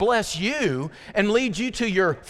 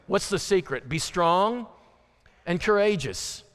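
ordinary talk

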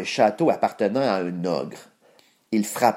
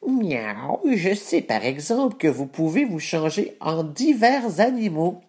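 cat voice; 7 semitones higher than usual. Acted. Cat character voice.

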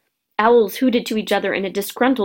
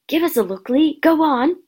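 eager pleading; 6 semitones higher than usual. A narrator's reading. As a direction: urge him on. Eager boys urge a friend.

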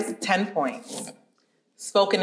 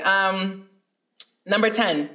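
ordinary talk, nothing marked

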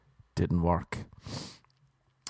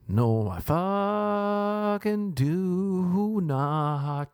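very slow, higher, stretched out in a put-on voice; drawn-out mock outrage; 7 semitones higher, much slower than usual. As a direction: drag each word out in mock disbelief. Mock indignant reply to question.

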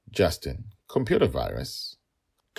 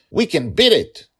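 put-on high, loud childlike cry; eager exclaim; 12 semitones higher, 11 dB louder than usual. A narrator's reading. Little girl eager to fight the machine, loud and high. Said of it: "Dragonlil exclaimed".

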